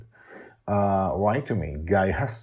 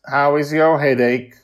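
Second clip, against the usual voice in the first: loud, level robotic voice; 9 semitones higher, 11 dB louder than usual. Acted. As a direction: ask like a caring robot. Mastermind asks.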